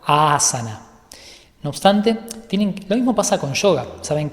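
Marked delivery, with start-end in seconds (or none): 0.1-0.9 asana with the first A held double length; "asana" said with a long first A; correct Sanskrit pronunciation. Shown as a demonstration. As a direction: draw out the opening A of asana for two beats. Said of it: "la pronunciación correcta sería algo así como".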